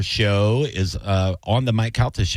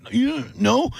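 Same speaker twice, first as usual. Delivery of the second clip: put-on high squeaky kid reply; cartoonish little kid voice. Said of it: "And I'd be like".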